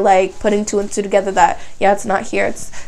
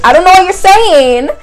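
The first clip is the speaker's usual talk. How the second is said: higher and louder, fast and irritated; annoyed retort; 6 semitones higher, 3 dB louder than usual. Retold or imitated. Teller brushing off two French guys, high loud annoyed. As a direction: snap back at the pestering guys. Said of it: "I'm like".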